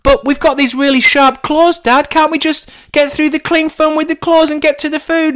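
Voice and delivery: very high, loud squeaky cub voice; an eager little cub pleading with dad; baby fox cub. Acted. Baby cub begging dad to claw through the cling film, squeaky and high. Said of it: "the little baby cub says".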